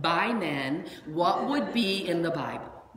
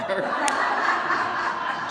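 real laugh; 2 semitones higher, 6 dB louder than usual. Real, not acted. Laughs at own car magnets.

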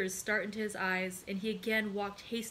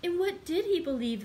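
high, louder, dismayed question; exclaiming; 8 semitones higher, 6 dB louder than usual. A narrator's reading. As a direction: ask it as an impassioned outcry. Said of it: "exclaimed Julie".

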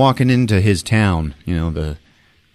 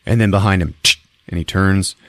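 usual speaking voice

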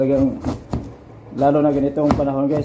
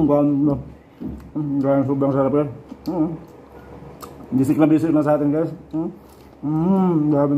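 chewing while talking; speaking between bites; mouth full; slower than usual. Real, not acted. Seen 3: bites pineapple at 0.1, then chews visibly throughout the words.

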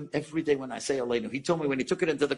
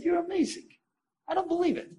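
very high-pitched, astonished disbelief; retold amazement; 13 semitones higher than usual. Son to the stranger who will visit his mother, amazed. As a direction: imitate a man marvelling, voice way up.

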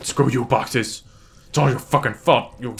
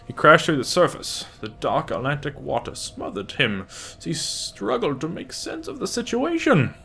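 high, lilting villain impression; Bane; 4 semitones higher than usual. Player reading game text aloud, mock villain voice. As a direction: put on a theatrical villain voice, rising high at the end. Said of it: "Why did I read that in Bane's voice?".

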